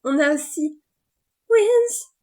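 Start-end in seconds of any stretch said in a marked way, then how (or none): 1.5-2.1 annonce criée, très aiguë; excité. Real, not acted. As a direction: lance le nom avec éclat. Enthousiasme en annonçant Winx. (cri d'enthousiasme aigu)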